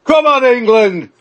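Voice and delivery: high, loud stadium cheer; rally the team with a big shout. Acted. Host asks each for a cheer.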